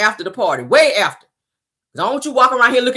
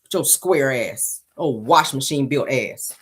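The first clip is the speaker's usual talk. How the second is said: slow insult; 6 semitones lower, 4 dB quieter, slower than usual. Real, not acted. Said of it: "insulting Simon".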